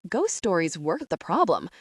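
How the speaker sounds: ordinary delivery, nothing marked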